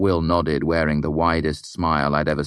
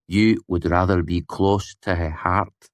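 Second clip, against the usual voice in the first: slow, deliberate statement; uninflected; 3 semitones higher, 4 dB louder, slower than usual. A narrator's reading. Willem corrects his friend's claim, uninflected. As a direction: say it slowly, without particular inflection. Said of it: "without any particular inflection".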